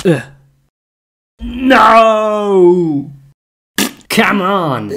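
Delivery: loud, high cry of frustration; frustrated shout. Real, not acted. Player yelling at the game after something goes wrong. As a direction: yell at the game in real dismay.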